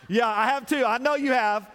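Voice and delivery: loud, high mock of fake-nice server; full-force preaching. Preacher acting out a fake-nice server to church. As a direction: preach it hard and mimic the fake smile.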